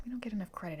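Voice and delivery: soft whisper, no voice; hushed whispered echo of the line; whispered repeat. Shown as a demonstration. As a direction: whisper it like an aside to the viewer.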